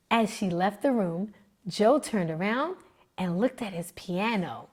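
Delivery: ordinary delivery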